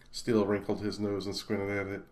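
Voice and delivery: ordinary delivery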